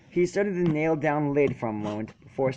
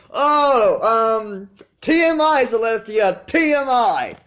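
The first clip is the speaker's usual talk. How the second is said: high, loud flustered yelp with a laugh; embarrassed outburst; 9 semitones higher, 6 dB louder, slower than usual. A narrator's reading. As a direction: blurt it out, mortified. Spike embarrassed by bath detail.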